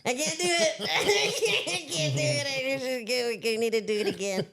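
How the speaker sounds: can't do it, giggling in protest; laughing refusal. Retold or imitated. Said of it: "I'm like".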